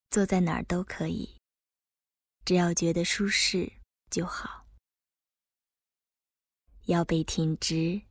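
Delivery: calm guide easing the body; guided meditation. Acted. Leads a body relaxation meditation.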